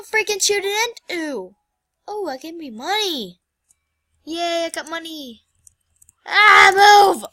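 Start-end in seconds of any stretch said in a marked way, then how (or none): 6.3-7.2 loud, sharp shout; frustrated yell. Real, not acted. Gamer yells at the game character to move. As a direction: yell at the thing to get out of the way.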